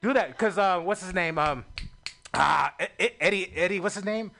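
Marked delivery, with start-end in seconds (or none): none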